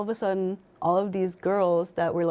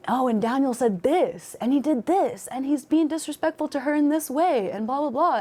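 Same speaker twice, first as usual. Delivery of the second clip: mocking the complaints; 7 semitones higher than usual. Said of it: "people were being like".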